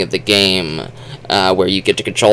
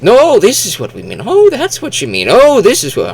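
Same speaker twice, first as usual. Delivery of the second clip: high, loud mock bickering; overlapping high "Oh" and "No" in a hammed-up debate; imitated back-and-forth; 12 semitones higher, 5 dB louder than usual. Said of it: "they go like".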